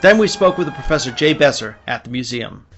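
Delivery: ordinary talk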